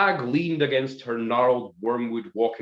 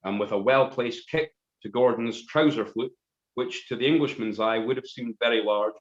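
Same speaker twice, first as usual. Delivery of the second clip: plain reading voice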